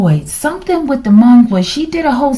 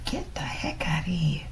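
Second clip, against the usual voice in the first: whisper; 5 semitones lower, 14 dB quieter than usual. Real, not acted. Muttering in disbelief over card.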